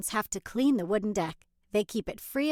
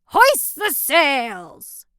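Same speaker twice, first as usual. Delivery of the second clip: slow loud pirate order; drawn-out pirate command; 4 semitones higher, 10 dB louder, much slower than usual. Acted. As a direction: call it out like a captain. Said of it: "Use your best pirate voice".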